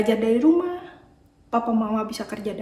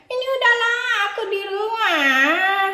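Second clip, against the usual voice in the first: put-on a whiny little boy who keeps complaining; sulky puppet boy; 11 semitones higher, 6 dB louder than usual. Puppet Adit voice.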